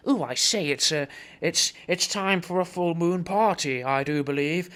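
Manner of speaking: posh hippie. Retold or imitated. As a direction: play a plummy hippie announcing the party. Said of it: "like that".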